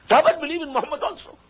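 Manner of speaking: high, urgent call to come and believe; fervent invitation. Real, not acted. Preacher calling the people of the book, fervent high appeal. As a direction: plead with the listeners to join.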